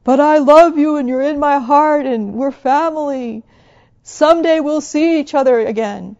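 loud, high call from far above; dad calling down. Retold or imitated. Father calling down the mountain to his children, loud tender call. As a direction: reassure the kids from the hilltop. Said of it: "The voice comes down the mountain".

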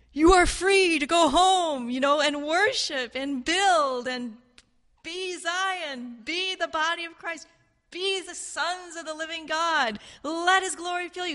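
King Cyrus proclaiming. Retold or imitated. Cyrus frees the band huddled in Babylon, proclaiming. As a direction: proclaim freedom, high and stretched out. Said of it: "coming to them and saying".

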